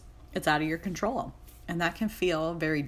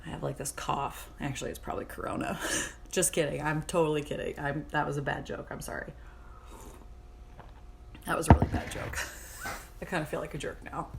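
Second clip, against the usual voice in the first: ordinary delivery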